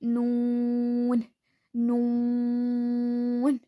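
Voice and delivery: नून slow, tongue edges to the side molars, nasal n; नून: long oo, ringing n; Arabic noon. Shown as a demonstration. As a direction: draw नून out slowly. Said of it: "जुबान का जो बीच का हिस्सा ना वो उठेगा".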